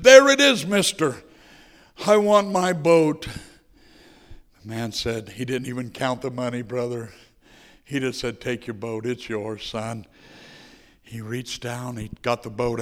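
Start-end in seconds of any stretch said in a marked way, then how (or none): 0.0-3.3 higher and louder, bursting out; a kid shouting there it is; triumphant boy. Acted. Boy slams money on counter. 8.4-10.1 put-on a soft old man's kindness; gentle shopkeeper. Shopkeeper gently gives boat.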